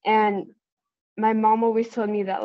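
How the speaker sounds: ordinary talk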